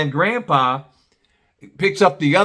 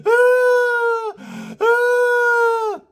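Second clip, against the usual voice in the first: high loud mock wail; loud high mock bawl; child bawling; 3 dB louder than usual. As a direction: wail like a crying toddler. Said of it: "starts bawling his eyes out".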